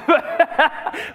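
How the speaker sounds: a burst of embarrassed laughter; nervous laugh. Real, not acted. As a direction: laugh it off, caught out. Nervous laugh, spotted colleague.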